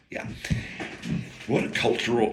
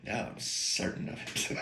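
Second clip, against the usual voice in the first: Doc Holliday; 3 semitones lower, 13 dB quieter than usual. Retold or imitated. Quoting Val Kilmer's Doc Holliday, a soft whispery gunslinger line. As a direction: breathe it out like a cool gunslinger. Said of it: "I had a Val Kilmer moment, Doc Holliday moment".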